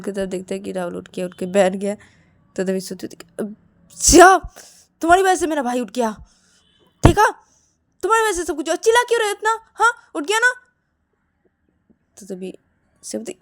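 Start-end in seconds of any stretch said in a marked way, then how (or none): 3.4-10.5 high, loud accusations; angry blaming. Acted. Shruti blames Siya for waking her brother, angry. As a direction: lay the blame, voice climbing.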